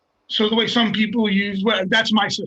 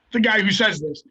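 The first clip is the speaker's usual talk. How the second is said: ordinary talk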